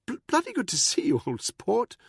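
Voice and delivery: high, loud, nervous stammer; stammering Buckingham. A narrator's reading. Buckingham cornered by Danny, stammering high. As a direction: trip over the words in a high upper-class voice. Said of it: "his tongue tripping over the words".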